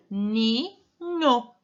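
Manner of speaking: Ninho split into ni, pause, nho; syllable by syllable. Shown as a demonstration. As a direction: break Ninho into two drawn-out beats, ni and nho. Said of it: "Presta atenção quando você falar a palavra". (Ninho separado em ni, pausa, nho)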